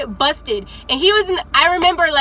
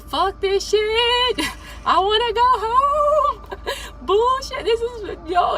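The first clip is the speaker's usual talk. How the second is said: high, soft, laughing complaints; grumbling recruit; 8 semitones higher, 4 dB quieter, slower than usual. Retold or imitated. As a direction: complain under your breath, laughing. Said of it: "the guy is behind me like".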